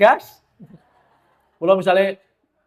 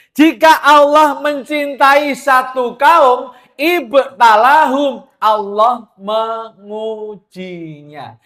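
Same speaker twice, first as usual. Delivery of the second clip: louder, higher, slow sermon voice; fervent preaching; 5 semitones higher, 6 dB louder, slower than usual. Acted. Preacher urging the women to cry out to God, fervent and slow. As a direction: preach it with rising passion.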